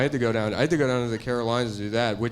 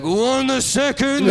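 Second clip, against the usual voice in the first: put-on much higher, very slow old rat master accent, laughing; mock Splinter rat accent; 11 semitones higher, 5 dB louder, much slower than usual. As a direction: drag it out like a wise old rat sensei. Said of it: "It's a rat accent".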